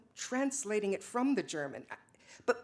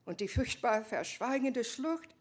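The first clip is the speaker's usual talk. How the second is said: reciting German; 2 semitones higher than usual. Acted. Lydia recites German to herself.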